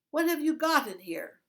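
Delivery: loud, high suspicious demand; suspicious guard. A narrator's reading. Guard holds up the rucksack, loud and suspicious. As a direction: demand an answer.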